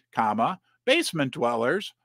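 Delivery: usual speaking voice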